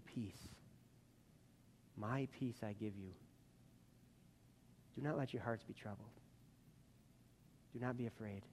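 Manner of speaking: calming voice. Acted. Jesus speaking peace to the troubled, softly calming. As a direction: hush them like a calm shepherd. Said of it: "with his calming voice".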